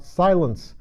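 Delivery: firm call for silence. A narrator's reading. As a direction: call the court to order. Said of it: "called out".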